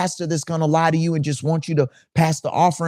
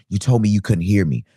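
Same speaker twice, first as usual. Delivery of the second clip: usual speaking voice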